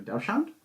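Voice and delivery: "dachshund" said DOW-shownt, unsure; dachshund as DOW-shund. Shown as a demonstration. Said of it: "How do you say it?".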